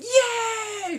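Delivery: high, loud cheer of joy; cheering crowd. Retold or imitated. Crowd cheers at queen's news.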